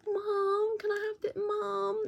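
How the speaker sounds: put-on very high whiny kid asking mom; begging child. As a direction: plead like a small child at a stall. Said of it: "her kids kept coming over to my stall and going".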